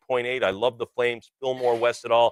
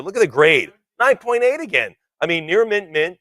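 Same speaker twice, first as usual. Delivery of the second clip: higher, louder rave over the grade; excited; 6 semitones higher, 6 dB louder than usual. Real, not acted. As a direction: sell the top grade with a burst of excitement. Auctioneer raving about top grade.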